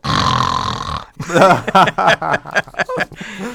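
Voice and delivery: huge noisy intake bursting into high, loud laughter; imitated gasping laugh. Nephew imitates his aunt who got everybody laughing, explosive laugh. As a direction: mimic an aunt's explosive laugh after a deep breath. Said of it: "take a deep breath and she'd go".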